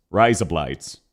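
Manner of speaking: low Aussie "Razor blades", like "rise up lights"; Australian accent. Shown as a demonstration. Streamer showing how an Australian would say it, low Australian accent. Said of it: "That is how an Australian would say".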